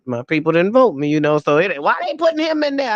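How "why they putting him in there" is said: high, quick mock protest; indignant retold complaint. Retelling her people's objection, indignantly. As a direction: imitate put-out supporters questioning the pick.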